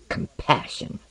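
low-voiced, nearly whispered echo of the word; stung echo. Osden echoes Tomiko's word compassion, quietly stung. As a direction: say it under your breath.

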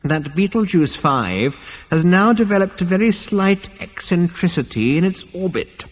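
put-on high, pedantic old man; ghostly old ancestor. A narrator's reading. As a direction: ramble on obliviously as an elder. Ancestor rambles on, ignoring crisis.

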